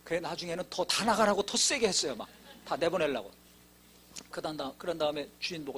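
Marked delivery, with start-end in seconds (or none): none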